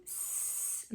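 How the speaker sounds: voiceless hissing s; hissed s. Shown as a demonstration. Teacher isolating the s sound. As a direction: hiss the s. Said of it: "Repare que é o S".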